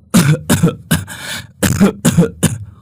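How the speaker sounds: weak sick cough. Acted. Sick old mother coughs.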